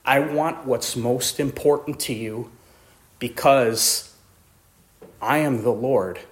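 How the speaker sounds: low, soft, solemn voice of God; hushed divine command. Acted. God asks Abraham to give up everything, hushed and solemn. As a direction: drop right down, grave and measured.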